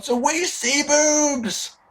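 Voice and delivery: high, drawn-out hammed-up cheer; mock excitement. Wrestling fan retelling the spot, mock-excited cheering. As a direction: whoop it slowly, stretching the last word.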